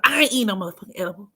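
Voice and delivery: fast, adamant imitation of friend; friend's refusal. Friend swearing off edibles, quick adamant refusal. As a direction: rattle off her refusal. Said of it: "She was".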